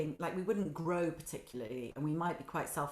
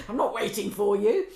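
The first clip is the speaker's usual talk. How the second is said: louder, laughing through the words; laughing retort; 8 dB louder than usual. Retold or imitated. Telling her husband she won't wait, laughing and louder.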